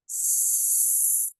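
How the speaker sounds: long hissing S through the teeth; teacher shows the exhale, a long unvoiced S. Shown as a demonstration. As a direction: breathe out a slow snake hiss. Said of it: "how long you can accentuate the S sound".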